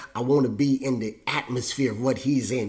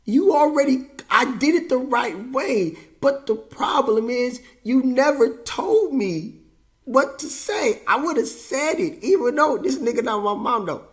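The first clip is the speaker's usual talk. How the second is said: aggrieved protest; 9 semitones higher, 3 dB louder than usual. Acted. Son arguing he was never told what to say, aggrieved. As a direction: plead your case, pitched well up.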